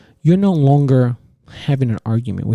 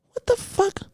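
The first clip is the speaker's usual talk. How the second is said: stunned squeak; 6 dB quieter than usual. Real, not acted. Outraged at couch banishment.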